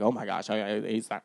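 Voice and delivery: low, soft flustered stammer; mock flustered believer. Mocking a believer caught flustered, low and soft. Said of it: "I don't want you to be like".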